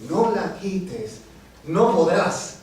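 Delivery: slow, firm poetic refrain; emphatic recitation. Acted. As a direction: declaim it with weight on each word. Poem line repeated with force.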